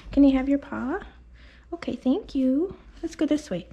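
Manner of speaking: high, gentle coaxing; coaxing pet voice. Real, not acted. Groomer asking the dog for his paw, high and gentle. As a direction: sweet-talk him into lifting a paw.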